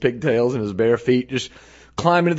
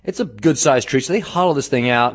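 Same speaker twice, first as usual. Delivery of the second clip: normal talking voice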